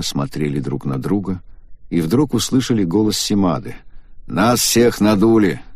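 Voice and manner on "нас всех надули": plain reading voice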